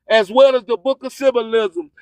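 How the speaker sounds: ordinary delivery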